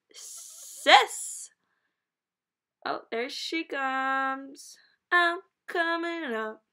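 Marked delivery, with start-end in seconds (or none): 0.1-1.5 loud, high call. Real, not acted. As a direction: yell for your sister. Calling her sister.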